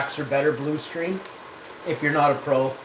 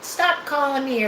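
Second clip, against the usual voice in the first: telling him off; 13 semitones higher than usual. Retold or imitated. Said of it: "she's like".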